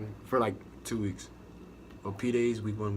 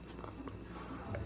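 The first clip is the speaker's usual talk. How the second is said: whispered aside; 13 dB quieter, much slower than usual. Real, not acted.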